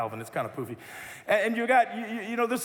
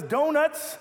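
tempter voice; 7 semitones higher, 3 dB louder than usual. Acted. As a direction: lure them with the word Donuts, higher. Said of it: "the accuser comes along and says".

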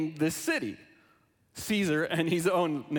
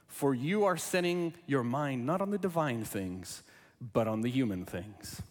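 measured, formal Bible reading; scripture reading; 4 semitones lower than usual. Acted. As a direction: read the Gospel to the congregation, lower and steady. Reads Gospel aloud before sermon.

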